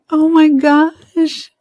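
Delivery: sharp gasp, then a loud, high, slow squeal. Real, not acted. Friend seeing the red hat up close, squealing loudly. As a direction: let it burst out, overwhelmed.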